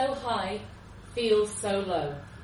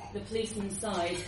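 ordinary delivery, nothing marked

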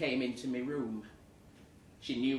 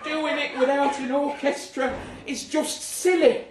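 much higher and louder, laughing exasperation; indignant rant; 11 semitones higher, 11 dB louder than usual. Acted. Diarist ranting about guitar Nessun Dorma, loud and high. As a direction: rant in outraged disbelief.

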